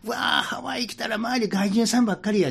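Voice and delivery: high, loud Osaka auntie exclaiming; Kansai auntie voice. Retold or imitated. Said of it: "関西のおばちゃんがね". (大声の関西のおばちゃん風)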